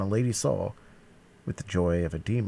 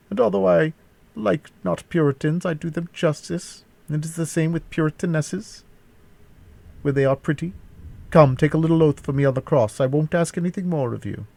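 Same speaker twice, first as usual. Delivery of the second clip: higher-pitched mock-coaxing villain; taunting captor; 9 semitones higher, 4 dB louder than usual. A narrator's reading. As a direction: coax the prisoner in a raised voice.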